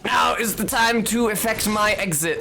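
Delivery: high, hammed-up panicked farewell; high theatrical fluster; dramatic exit. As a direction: announce a hasty exit in mock alarm. Acting out a hasty dramatic exit.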